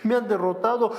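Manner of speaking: mock whining of a defeated Joshua; Joshua lamenting. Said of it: "Josué que estaba ahí llorando".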